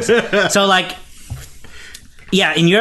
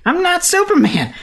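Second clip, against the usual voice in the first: very high, hammed-up protest; exaggerated Superman denial; 12 semitones higher than usual. Clark grilled by Lois, exaggerated flustered denial. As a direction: squeal it like a cornered liar. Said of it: "I'm doing a lot of exaggerating".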